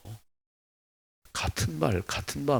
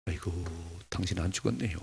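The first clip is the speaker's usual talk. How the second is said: mock sigh; 3 semitones lower, 8 dB quieter than usual. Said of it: "이렇게 막".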